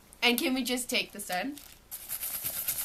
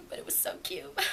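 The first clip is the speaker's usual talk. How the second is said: whispered gush; 7 semitones lower, 9 dB quieter than usual. Real, not acted. Quiet gushing aside.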